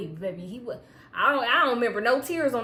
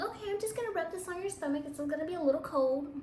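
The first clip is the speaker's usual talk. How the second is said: higher, brisk, a lightly voiced nurse; 6 semitones higher, 5 dB quieter, faster than usual. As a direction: lightly mimic the nurse, fast. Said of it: "She's like".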